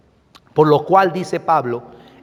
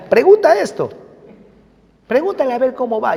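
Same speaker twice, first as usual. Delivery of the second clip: high, louder light voice for God's prompting; voicing the Lord; 9 semitones higher, 4 dB louder, faster than usual. Pastor voicing God's whisper to him, high and bright. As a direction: play the Lord's nudge, high and quick. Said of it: "el Señor nos dice".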